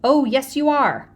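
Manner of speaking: loud, stern big-brother snap; older brother cutting a kid off, loud; firm warning. A narrator's reading. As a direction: shut him down hard and loud. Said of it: "I told him".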